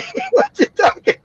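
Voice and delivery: high laughing quote; giggling quote. Real, not acted. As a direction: laugh through the words. Laughing while quoting brother.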